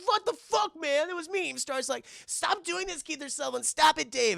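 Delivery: high, strained protest; hammed-up upset teen voice; humiliated Michael yelling at the laughing gang; whiny angry protest.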